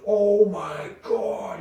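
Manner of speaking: slow, drawn-out awe; stretched out in amazement; awed buddy. Retold or imitated. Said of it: "he goes".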